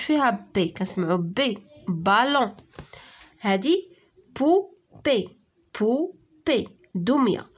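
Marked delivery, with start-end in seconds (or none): 4.4-6.7 بوبي as two separate beats with a clear gap; syllable by syllable. Shown as a demonstration. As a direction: split بوبي in two for the class. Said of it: "كنسمعوها في لي دو سيلاب". (بوبي مقطعة على جوج سيلاب)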